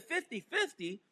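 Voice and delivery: incredulous echo. Retold or imitated. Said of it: "I said".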